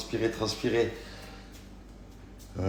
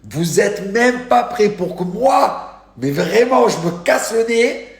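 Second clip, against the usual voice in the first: loud, high-pitched exclaiming; stretched, emphatic hype at full voice; over-the-top amazement; 11 semitones higher, 8 dB louder, slower than usual. Real, not acted. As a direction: shout it like you cannot believe it. Hyping an unbelievable lookalike photo.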